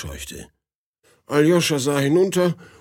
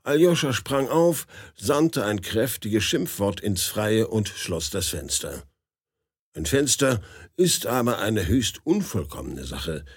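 plain reading voice

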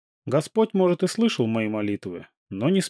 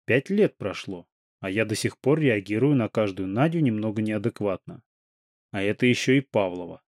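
ordinary delivery, nothing marked

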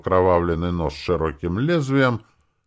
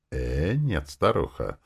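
ordinary delivery

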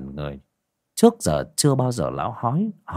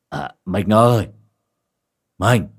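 louder drawn-out call to the wife; 6 dB louder, slower than usual. Acted. Quyền calling his wife up from the kitchen.

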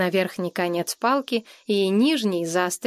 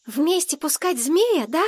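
put-on boy's voice clearing; 7 semitones higher than usual. A narrator's reading. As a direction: ask like a boy daring to hope. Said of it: "В голосе мальчика исчезла сепловатость".

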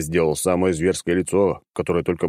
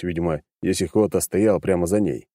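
usual speaking voice

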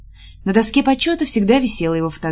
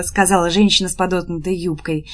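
ordinary delivery

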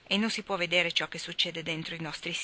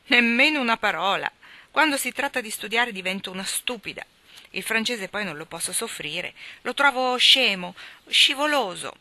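loud, blunt tomboy retort; blunt, brusque; 5 semitones higher, 6 dB louder than usual. Acted. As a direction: snap it out bluntly, no patience. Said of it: "Jo non lasciava il tono brusco". (tono brusco e insofferente, più forte)

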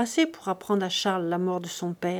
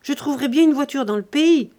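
raised, higher voice, pressing; urgent loud demand; 5 semitones higher, 6 dB louder than usual. Acted. Charles to his uncle, insisting loudly on leaving. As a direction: demand the horses at once.

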